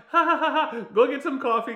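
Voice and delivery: high, loud fake laugh covering awkwardness; forced laugh. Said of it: "He's like".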